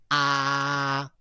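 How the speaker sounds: long, level "Ah"; open-mouthed "Ah" held steady; first tone. Shown as a demonstration. Remember going to see a dentist, level open "Ah". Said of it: "you open your mouth".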